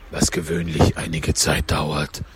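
low, slow Gryphon voice; Gryphon interjecting. A narrator's reading. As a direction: drop deep for a slow aside. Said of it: "unterbrach der Greif". (tiefe, langsame Greifenstimme)

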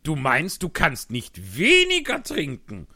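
loud, excited Hatter voice. Acted. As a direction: tell it like an agitated Hatter. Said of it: "sagte der Hutmacher". (lauter, aufgeregter Hutmacher)

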